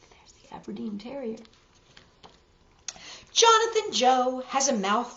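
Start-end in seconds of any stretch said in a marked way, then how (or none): 0.1-1.4 whisper. Real, not acted. Aside while showing a picture.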